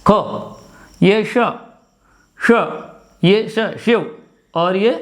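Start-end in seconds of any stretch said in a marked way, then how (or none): none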